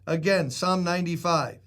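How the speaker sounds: ordinary talk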